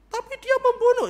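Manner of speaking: very high, loud, strained cry; desperate cry. Acted. Esther crying out desperately against the king's excuse. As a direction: break in with anguish.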